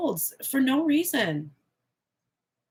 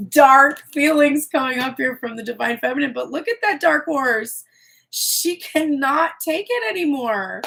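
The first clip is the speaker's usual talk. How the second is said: louder, higher, worked up; excited; 5 semitones higher, 6 dB louder than usual. Real, not acted. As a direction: lean in with rising alarm. Excited reading of dark cards.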